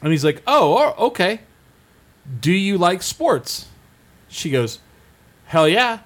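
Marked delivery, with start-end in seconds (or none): 0.4-3.7 mock innocent comedian. Comedian baiting a heckler with fake innocence, slow. 5.4-6.0 high, loud hell yeah; gung-ho fan. Retold or imitated. Heckler shouts back to comedian, mock gung-ho.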